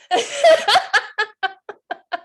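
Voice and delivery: a high, delighted laugh; bright laugh. Real, not acted. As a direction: laugh with pride at the class. Delighted by students' work.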